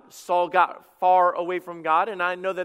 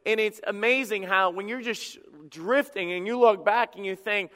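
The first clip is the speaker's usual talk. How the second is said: ordinary talk, nothing marked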